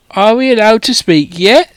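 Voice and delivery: normal talking voice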